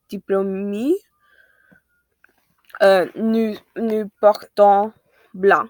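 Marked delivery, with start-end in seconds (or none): none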